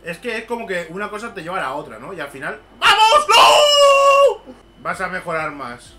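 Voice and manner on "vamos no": very high, loud cry of despair; anguished shout. Real, not acted. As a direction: cheer then cry out in dismay. Cheer turning to anguish.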